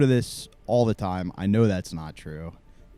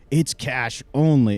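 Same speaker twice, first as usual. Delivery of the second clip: slow, louder frustrated insistence; more frustrated; 3 semitones higher, 5 dB louder, slower than usual. Retold or imitated. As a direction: draw it out, losing patience. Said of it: "gets a little more frustrated".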